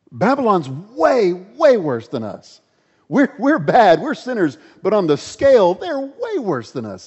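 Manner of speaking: can't believe what he just heard; protesting prophet. Acted. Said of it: "The prophet says".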